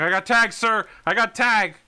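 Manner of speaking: high, loud battlefield report; urgent radio shout. Acted. Soldier reporting tag to the commander over comms, high urgent shout. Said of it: "someone else is just going".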